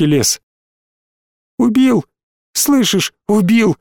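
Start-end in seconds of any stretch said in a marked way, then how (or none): 1.6-3.8 high, horrified exclamations; panicked cry. Acted. Small boy reports killing to his mate, panicked. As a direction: cry out in high-pitched alarm.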